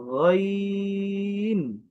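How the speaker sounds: long sung গইন, throat sound held; teacher sings গইন slowly from the throat; drawn-out ghain. Shown as a demonstration. Arabic teacher drawing out the letter name. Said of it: "আমি আবারও বলছি".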